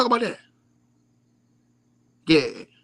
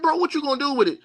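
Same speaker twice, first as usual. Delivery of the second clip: retold incredulous; 11 semitones higher, faster than usual. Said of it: "I said".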